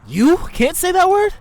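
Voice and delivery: loud, high, drawn-out disbelief; incredulous question. Real, not acted. Challenging a friend who dropped a word, incredulous. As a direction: question it slowly, pitch leaping up.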